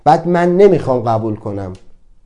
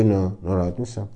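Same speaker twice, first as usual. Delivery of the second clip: lower, quieter brush-off; dismissive denial; 6 semitones lower, 8 dB quieter than usual. Retold or imitated. A man asked if he's upset brushes it off quietly. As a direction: shrug the question away, low and flat. Men denying feelings, acted.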